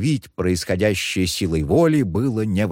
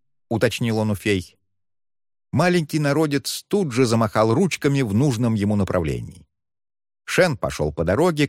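usual speaking voice

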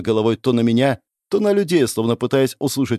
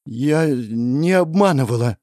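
slow, forced through pain; struggling to get the words out; strained denial; 4 semitones higher, slower than usual. Acted. Princess answers the goddess with great effort. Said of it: "с трудом ответила принцесса".

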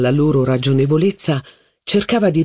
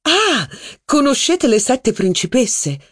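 higher, lively society duchess; a duchess holding court, higher; haughty duchess; 9 semitones higher than usual. Acted. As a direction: gossip grandly about her cousin's wife. Duchess voiced in reading.

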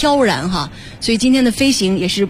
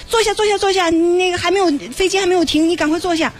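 anxious flight attendant; 8 semitones higher than usual. Retold or imitated. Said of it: "然后乘务员着急说".

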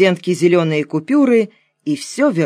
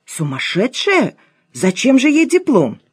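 astonished; 7 semitones higher than usual. A narrator's reading. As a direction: react in open disbelief. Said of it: "Лена страшно удивилась". (изумлённо)

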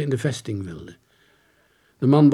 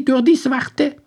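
put-on high, loud, bewildered old soldier; 14 semitones higher, 8 dB louder, slower than usual. Soldier pleading his story to the detective, high and loud. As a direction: pitch him up high and loud as the lost soldier.

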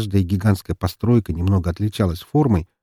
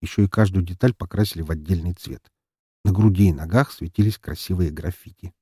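usual speaking voice

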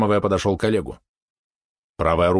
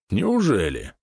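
feigned surprise; slower than usual. Acted. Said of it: "делано удивился Олег".

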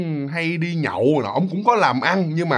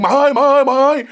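high, loud panicked calling out; frantic yelling; 8 semitones higher, 3 dB louder than usual. Retold or imitated. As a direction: scream for grandma in panic. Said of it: "bà la liền".

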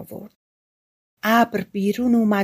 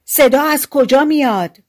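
raised, higher-pitched questioning call; loud call; 6 semitones higher, 6 dB louder than usual. A narrator's reading. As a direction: call out loudly to an unseen speaker. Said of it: "با صدای بلند گفت".

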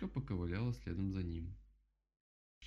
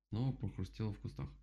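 plain reading voice

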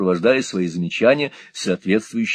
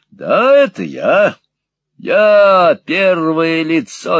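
slow, loud, raised-pitch declaiming; loud, pedantic; 6 semitones higher, 5 dB louder, slower than usual. A narrator's reading. Squeers answers the hard-of-hearing Peg with grammar, loud and pedantic. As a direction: spell it out loudly and slowly. Shouting slowly to deaf old woman.

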